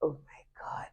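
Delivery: hushed whisper. Real, not acted. Retelling the crying scene, whispered aside. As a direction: breathe it out as a secret.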